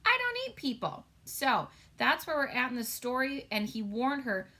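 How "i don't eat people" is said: high, bright reassuring no; reassuring giant. Retold or imitated. Teacher voicing the giant, playfully reassuring. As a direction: giant gently setting her at ease. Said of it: "he said".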